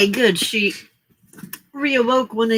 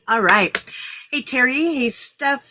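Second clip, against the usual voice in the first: ordinary talk, nothing marked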